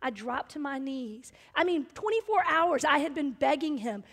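usual speaking voice

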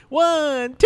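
high, drawn-out count; movie character counting. Retold or imitated. Co-host showing how the character counts for him, high movie-character voice. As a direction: stretch each number out, climbing in pitch. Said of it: "He tells him".

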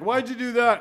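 high, loud baffled question; incredulous. Retold or imitated. Friend seeing the air casts, high and incredulous. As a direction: demand to know why. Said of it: "I was like".

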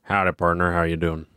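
low, slow southern cowboy drawl. Acted. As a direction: greet like a Western cowboy. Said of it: "that sounds like a cowboy".

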